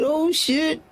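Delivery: high startled gasp of oh shit; shocked. Retold or imitated. Inmate spotting a gang downstairs, high startled exclaim. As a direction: react like you just saw the crowd.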